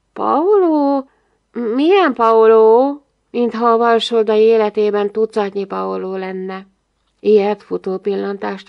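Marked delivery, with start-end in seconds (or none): 0.1-3.0 raised, airy, feigned puzzlement; a touch of thin glass in a drawn-out question; fragile glassy voice. A narrator's reading. Big girl feigning ignorance of Paolo, fragile glassy voice. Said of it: "törékeny üveghangon mondta".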